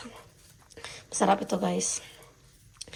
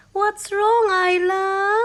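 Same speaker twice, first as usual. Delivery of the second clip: coaxing; 9 semitones higher, 3 dB louder, much slower than usual. Real, not acted. Asking baby twice.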